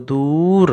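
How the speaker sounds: দূর with a long, stretched u; drawn-out vowel. Shown as a demonstration. Teacher models the word for pupils, দূর drawn out. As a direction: pull the u of দূর out before the r. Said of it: "এর উচ্চারণটাও আমরা একটু টেনে পড়ব".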